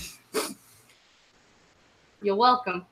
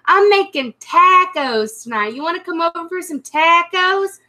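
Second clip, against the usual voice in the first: put-on Southern accent; 3 semitones higher than usual. Retelling an invitation to tacos, mock Southern accent. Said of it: "I've heard someone go".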